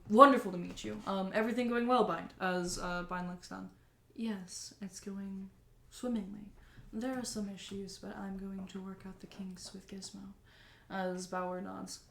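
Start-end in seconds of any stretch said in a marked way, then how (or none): 0.1-2.3 lightly voiced businessman; welcoming and chatty; friendly mayor voice. Mayor voice again. 4.1-10.3 soft, dropping to a whisper; quiet, dutiful machine; robot voice. Acted. GM voices Bind's reply.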